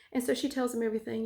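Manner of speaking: ordinary talk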